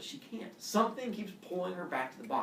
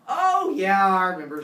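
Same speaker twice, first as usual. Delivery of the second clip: flustered remembering; 4 semitones higher, 11 dB louder than usual. Acted. Epimetheus caught off guard.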